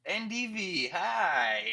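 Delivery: loud, high hello; excited greeting. Real, not acted. Streamer greeting a raider by name, high and loud. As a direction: call out a happy hello to the newcomer.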